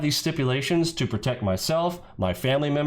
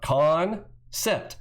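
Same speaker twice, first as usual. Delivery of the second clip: CON drawn out, a pause, then SEPT; "Con" stretched and set apart from "Sept"; split after CON; 4 semitones higher than usual. Shown as a demonstration. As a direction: break "Con Sept" into two separate words. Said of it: "starts with the con in the front".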